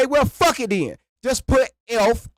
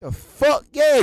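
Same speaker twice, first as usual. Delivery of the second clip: usual speaking voice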